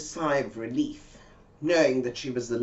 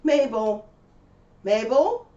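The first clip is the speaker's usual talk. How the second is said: raised call of a name, louder and higher; calling Mabel, higher and louder; 8 semitones higher, 7 dB louder than usual. Retold or imitated. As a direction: call out to her friend through the house.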